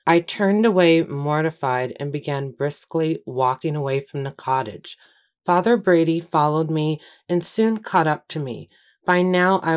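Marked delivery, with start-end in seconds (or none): none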